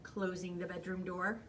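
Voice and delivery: ordinary delivery, nothing marked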